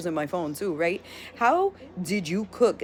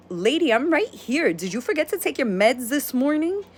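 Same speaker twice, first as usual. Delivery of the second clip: higher and louder, fed up; exasperated retort; 7 semitones higher, 4 dB louder than usual. Retold or imitated. Dana answering the screaming boss, exasperated. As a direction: snap back at her in exasperation.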